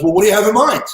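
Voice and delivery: a rough try at London English; bad London accent. Branson asking what he has in mind, bad London accent. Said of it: "I have a horrible London accent".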